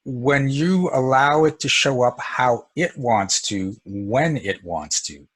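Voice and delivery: slow, deliberate, each phrase laid down; unhurried teaching emphasis. Real, not acted. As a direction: spell out the key idea slowly. Teaching point, slowed for emphasis.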